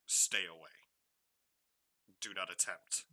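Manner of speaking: firm, measured written warning; strict warning. Acted. Game master reading a door marking to the player, strictly. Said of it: "just strictly states".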